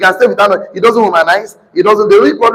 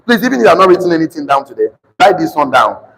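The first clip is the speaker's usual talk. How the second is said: usual speaking voice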